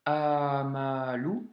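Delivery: slow "amalu" with drawn-out vowels; stretched syllable-by-syllable demo of "amalu"; long A. Shown as a demonstration. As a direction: hold the first A of "amalu" long. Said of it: "qui donne le A long".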